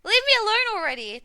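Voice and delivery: shouted approval; loud cheering. Real, not acted. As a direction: yell it out in triumph. Cheering the outburst.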